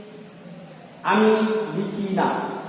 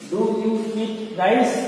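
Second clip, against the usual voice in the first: very slow, each word drawn out for students to write; teacher dictating at a crawl; slow dictation; much slower than usual. Shown as a demonstration. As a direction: spell it out slowly so the class can copy Do you eat rice?. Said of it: "teacher dictating to class".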